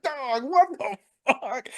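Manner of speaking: high, slow wail of disbelief; losing his mind. Retold or imitated. Fan reliving the chokehold, freaked-out wail. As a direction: drag the question out high and incredulous. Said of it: "I'm losing my mind".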